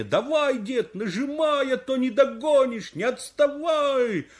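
high, drawn-out urging calls; high-pitched roadside cheer, stretched vowels; crowd cheering on. Acted. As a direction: call out like spectators egging a runner on. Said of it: "кричит мне".

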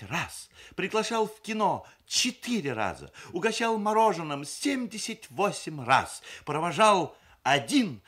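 high, loud tally of figures; bride's official report. A narrator's reading. Люда reporting courtship statistics to relatives, officiously. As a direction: hammer out each count like an accountant's report.

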